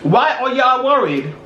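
usual speaking voice

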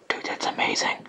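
breathy excited whisper. Real, not acted. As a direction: whisper it in awe. Whispering over a find.

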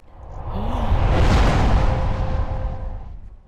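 huge dramatic gasp; loud shocked gasp. Real, not acted. Shocked loading screen reaction.

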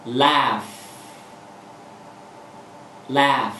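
a bright, clear L flicked down into laugh. Shown as a demonstration. Teacher demonstrating the clear L in laugh. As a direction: laugh said with the tongue tip tapping and dropping off the ridge. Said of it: "lúc bắt đầu phát âm là cái đầu lưỡi".

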